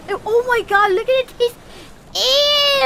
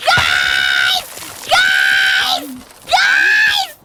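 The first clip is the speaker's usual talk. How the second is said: calling out; much slower than usual. Real, not acted. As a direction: shout for the others. Calling out to teammates.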